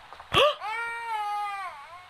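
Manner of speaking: sharp high gasp of alarm; shocked high gasp. Real, not acted. Baby escaped again.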